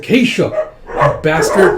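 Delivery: high, loud cursing yell; furious shout. Retold or imitated. Grieving prince yelling curses at the killer. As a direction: scream curses at the traitor.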